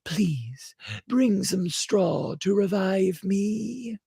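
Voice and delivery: high, breathy, fading plea; faint high voice of a dying ember; dying spark. A narrator's reading. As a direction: plead like a spark about to go out. Dying spark begging for straw.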